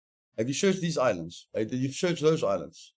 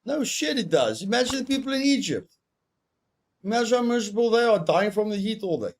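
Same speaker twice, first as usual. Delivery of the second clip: worked-up rant; 8 semitones higher than usual. Real, not acted. Heated rant about hot countries.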